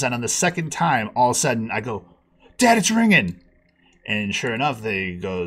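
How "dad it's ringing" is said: higher, louder urgent excitement; excited. Retold or imitated. Son telling dad the contest line is ringing, excited. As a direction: blurt it out, the call is through. Radio contest call finally connects.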